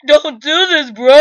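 mock-tearful plea to a friend who is leaving. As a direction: beg your friend in jest, half laughing. Begging friend not to leave.

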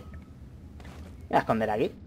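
slow, breathless whisper while sneaking; hiding whisper. Real, not acted. Player hiding from an enemy, slow whisper. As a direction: whisper it so the enemy can't hear.

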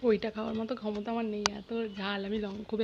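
speaking between bites; talking while chewing. Real, not acted. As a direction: mouth partly full of food. Seen 0: Food put in mouth at -3; chewing motions, hand covers mouth.

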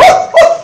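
very high breathless gasps; mock jolted gasp; startled gasps. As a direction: jolt awake with gasps. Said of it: "you go".

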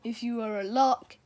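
plain reading voice

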